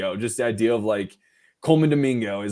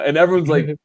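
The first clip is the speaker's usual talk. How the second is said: ordinary talk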